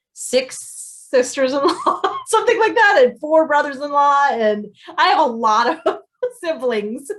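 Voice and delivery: laughing count. Real, not acted. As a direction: laugh while losing count. Laughs counting her in-laws.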